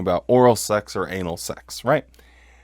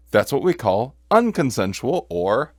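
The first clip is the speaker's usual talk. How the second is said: usual speaking voice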